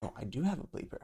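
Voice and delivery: ordinary talk, nothing marked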